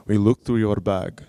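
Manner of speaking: badly faked Israeli accent that comes out Russian; Russian-sounding accent. Security guard confronts traveler over his bag, imitated Russian-sounding accent. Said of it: "my accent is gonna sound Russian".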